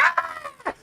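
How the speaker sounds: high, sudden shriek of fright; startled scream. Retold or imitated. Friends scared by a click of the empty trigger, high startled shriek. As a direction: shriek like someone just scared out of their wits. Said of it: "And they was like".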